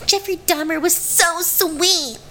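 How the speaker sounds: mock the starry-eyed true crime fan; gushing fan. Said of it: "they're gonna be like".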